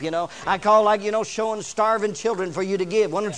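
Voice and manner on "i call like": usual speaking voice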